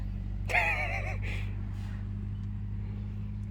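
long, airless wheezing laugh; breathy laugh. Real, not acted. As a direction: laugh until no voice comes out. Long laugh during chat. (หัวเราะเสียงลมยาว)

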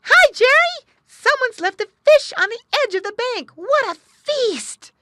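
very high, loud, joyful yell; delighted shout. Acted. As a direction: call out overjoyed at a free feast. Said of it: "he shouted in delight".

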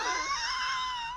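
high, soft squeal of laughter; high giggling shriek. Real, not acted. Laughing at scene.

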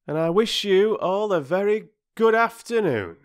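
high, loud, icy politeness from an offended porter; pointedly formal farewell; huffy dismissal. A narrator's reading. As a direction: dismiss them with exaggerated courtesy. Offended Perks dismisses children.

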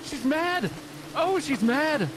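very high, frantic alarm; panicked warning. Real, not acted. Warning the others Anna is downstairs, panicked. Warns that the angry demon is near.

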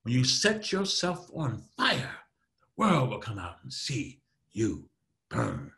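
slow, weighty words with pauses between; drawn-out, punched emphasis. Shown as a demonstration. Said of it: "Loud and slow is for emphasis".

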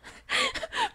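shrill squeal, very high; high squealing laugh. Real, not acted. Streamer laughing at calling a gorilla a bear, high squeal. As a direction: squeal at your own mistake.

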